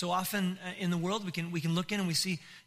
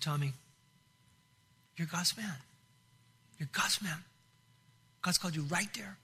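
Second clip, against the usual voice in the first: retold fatherly encouragement, hushed; gentle reassurance. Retold pastor reassuring him on phone.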